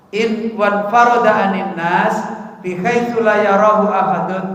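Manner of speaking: measured, firm Arabic recitation of the text; reading the kitab aloud. Acted. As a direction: read it out like a classical text lesson.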